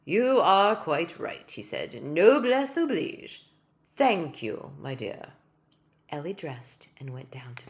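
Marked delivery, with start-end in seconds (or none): 0.1-5.3 loud, slow and gracious; dignified tiny king. Acted. Tiny king thanking Ellie, slow and dignified. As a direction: concede like a gentleman royal. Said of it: "inclined his head with dignity".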